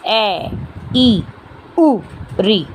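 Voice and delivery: "ए, ई, उ, री" as brief separate vowels, a, i, u, ri; short vowels. Shown as a demonstration. Teacher listing the shortest vowels for students, short "ए, ई, उ, री". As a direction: give each of "ए, ई, उ, री" one short beat. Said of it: "सबसे कम मात्रा का समय लगे".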